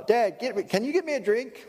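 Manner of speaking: put-on high whiny kid voice begging dad; whiny child. Child at the dinner table whining to dad for a drink.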